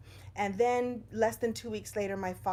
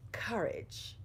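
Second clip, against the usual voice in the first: Courage said again as a soft whisper; whispered repeat; 5 dB quieter than usual. Real, not acted. Speaker repeats the key word to viewers, whispered. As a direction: breathe Courage out in a hush.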